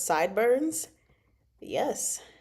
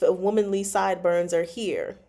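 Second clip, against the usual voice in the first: normal talking voice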